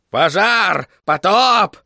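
high, loud cries of alarm; parrot cry. A narrator's reading. Second parrot head joining the alarm, crying out. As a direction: shout each word as a rising alarm. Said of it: "подхватила другая".

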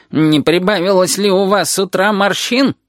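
irritated needling. A narrator's reading. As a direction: needle her with mock inquiry. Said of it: "раздражённо осведомился Гомер у душеньки". (раздражённая язвительность)

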